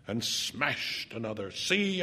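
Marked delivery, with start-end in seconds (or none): 1.5-2.0 a contained, high narrated shout. A narrator's reading. Smashing mirrors for Monica to see, shouting. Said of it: "I shouted".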